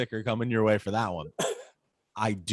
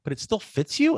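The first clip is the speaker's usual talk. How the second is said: put-on higher, incredulous kid voice; imitating his son; 10 semitones higher than usual. Son amazed the old shirt still fits, high kid voice. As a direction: play the son, unable to believe it. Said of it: "He's like".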